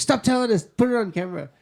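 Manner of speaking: loud, high-pitched retold plea; urgent demand. Co-host re-enacts telling the guest to save it for camera, urgent. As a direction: insist loudly, jumping up in pitch.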